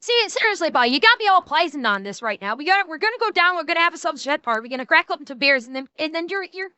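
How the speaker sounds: fast, high-pitched Newfoundland dialect; Newfie accent. Shown as a demonstration. Streamer showing how Newfoundlanders talk, in a Newfie accent. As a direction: rattle it off in a thick Irish-sounding Newfie accent. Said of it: "like, that's Newfie".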